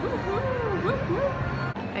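high excited woo-hoo; cheering. Real, not acted. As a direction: celebrate it in high whoops. Cheering the still-open shop.

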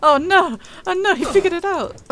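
high, loud "oh no" in real alarm; panicked excitement. Real, not acted. Player panics as the enemy figures out the post, alarmed yelp. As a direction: yelp it as he comes around the post.

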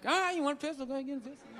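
country guy losing interest mid-sentence. Retold or imitated. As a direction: start at speaking level, then trail off softly. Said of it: "that redneck trailing off thing".

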